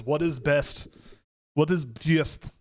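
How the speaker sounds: light mock Russian accent, slow and drawn out on the word best; a hint of a Russian accent, dragging each word; John Malkovich Russian accent. Podcast host jokingly mimics a Russian accent, slow and deliberate. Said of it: "That's my John Malkovich doing a Russian accent impression".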